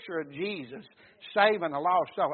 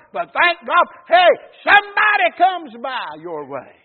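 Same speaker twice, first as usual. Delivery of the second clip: fervent preaching; 6 semitones higher, 9 dB louder than usual. Real, not acted. Preacher likens the wandering sinner to his listeners, building fervent preaching. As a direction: build to a raised pulpit cry.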